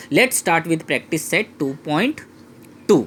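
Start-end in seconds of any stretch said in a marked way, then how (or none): none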